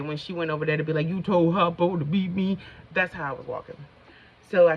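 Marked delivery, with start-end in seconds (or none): 1.1-2.6 lightly voiced movie quote, louder; Miss Sophia. Quoting The Color Purple to viewers, loud imitation. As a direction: belt it out as a mild impression. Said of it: "like Miss Sophia".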